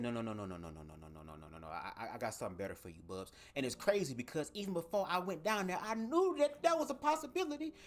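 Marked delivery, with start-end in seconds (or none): 0.0-3.3 deep, hushed voice of the universe, far below his own; the universe gently overruling him, low and soft. Acted. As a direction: cosmic voice dropping low and quiet. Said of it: "the universe came through and said".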